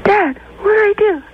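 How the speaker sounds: high, pleading son voice; clueless son. Acted. Son on a walkie-talkie asks Dad for help, high and helpless. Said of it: "And then he goes".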